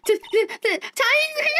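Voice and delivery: high shrieking alarm at the tiger; panicked shout. Acted. Companion screaming at a charging tiger, terrified. Character spots a tiger, voiced.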